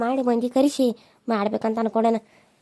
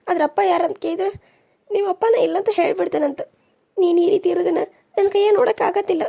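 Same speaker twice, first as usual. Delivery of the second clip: higher, tearful plea with a sob; tearful pleading; 6 semitones higher than usual. Acted. Young woman begging her uncle through tears, higher and crying. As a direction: beg him through tears.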